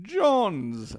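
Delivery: light Connery impression of one name; Sean Connery's Jones. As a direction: lean into the Connery burr on Jones. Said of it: "his line of saying".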